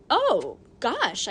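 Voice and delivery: a loud startled "Oh, gosh"; startled gasp. Acted. Boy startled by the old lady, a loud gasp. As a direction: jump at the sudden voice.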